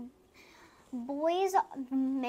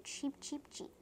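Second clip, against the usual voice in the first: soft little cheeps; birds cheeping; 2 semitones higher, 9 dB quieter than usual. A narrator's reading. Child reading what birdies say, soft cheeping. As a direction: softly imitate chirping birds. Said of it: "Birdies and sheep go".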